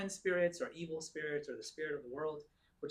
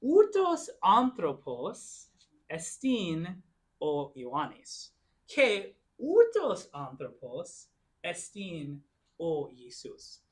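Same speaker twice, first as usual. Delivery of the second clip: slow Greek example; 5 dB louder, much slower than usual. Shown as a demonstration. Teacher modelling a Greek sentence for the class, slowly. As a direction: say it slowly, stressing outos. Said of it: "I would say".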